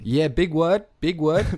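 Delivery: put-on Aussie mate; Australian accent. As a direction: answer proudly in mock Australian. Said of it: "you've sustained this accent".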